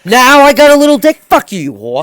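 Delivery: heated retold yell; retold angry reply. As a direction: tear into her. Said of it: "retold reply to ex".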